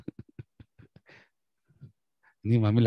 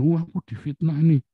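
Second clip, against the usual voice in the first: mock wounded whine, higher and louder; self-pitying complaint; 7 semitones higher, 4 dB louder than usual. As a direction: play the hurt victim, fishing for sympathy. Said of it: "Kadang-kadang kita geeran".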